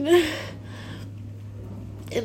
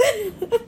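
brief breathy giggle; short laugh; 4 semitones higher than usual. Real, not acted. Speaker laughs at sudden cold, short giggle. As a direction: break into a quick giggle.

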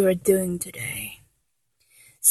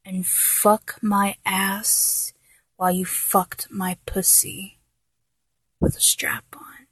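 whispered; 9 dB quieter than usual. Acted. Mocks his emailed fantasy.

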